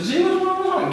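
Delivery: put-on very high little-girl voice; little sister. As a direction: ask like a small curious sister. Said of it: "So my little sister".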